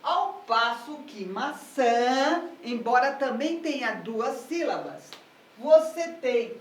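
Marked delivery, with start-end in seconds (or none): 1.2-2.5 "maçã" with a long, strong çã; stress on last syllable. Shown as a demonstration. Teacher contrasting it with pera, "maçã" stressed on çã. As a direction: stretch and push the final çã of "maçã". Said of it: "a sílaba tônica aqui".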